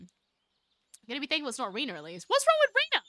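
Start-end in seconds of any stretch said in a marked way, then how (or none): 2.3-3.0 put-on high girl voice. High-voiced girl mock outrage.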